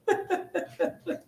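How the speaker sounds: a sudden high, airy laugh. Real, not acted. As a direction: let a real laugh slip out. Medium laughs, emotional reading.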